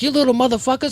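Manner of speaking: high, squeaky mock scold; hammed-up high-pitched scolding; mock yell. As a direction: play a fake angry scold at the kids. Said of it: "I was about to be like".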